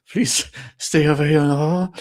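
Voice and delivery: retold scared plea to keep away; mock nervous plea. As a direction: plead in mock fear, dragging it out. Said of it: "I was constantly like".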